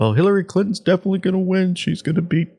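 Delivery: high, fast mimicry of smug pundits; mock-confident pundit impression; mocking media voice. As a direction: parody the confident newscasters. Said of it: "just like they did, against President Trump".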